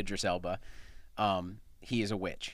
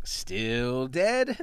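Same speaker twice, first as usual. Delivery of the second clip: lightly voiced graveyard watchman calling out; watchman's call; 3 semitones higher, 5 dB louder than usual. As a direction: call it like a bored night watchman checking graves. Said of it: "and he's saying".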